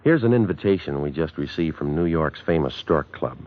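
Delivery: ordinary talk, nothing marked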